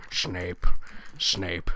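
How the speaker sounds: low, slow drawn-out Snape; mock Severus Snape, deep and slow; Snape impression. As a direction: intone the name slowly and low, like the Potions master. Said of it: "No, Snape".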